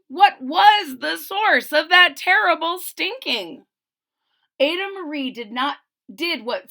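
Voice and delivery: rhyming picture-book read-aloud; storybook narrator; story narration. A narrator's reading. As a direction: read to young children, landing each phrase.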